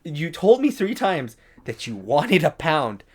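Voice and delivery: reenacted strained self-control; trying not to lose it. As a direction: spell it out loudly, holding back exasperation. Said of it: "my voice is cracking because I'm trying not to lose it".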